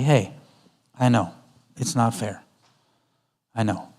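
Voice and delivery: soft, slowing, fading reassurance; gently calm the child; quiet understanding tone; soothing empathy. Shown as a demonstration. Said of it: "instead of that".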